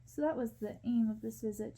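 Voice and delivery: ordinary delivery, nothing marked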